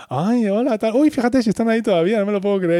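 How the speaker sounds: high, delighted nosy voice; gossipy Phoebe. Retold or imitated. Phoebe, eavesdropping on the upstairs neighbours, thrilled. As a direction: squeal at what's happening upstairs. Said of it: "empieza a oírse a Phoebe".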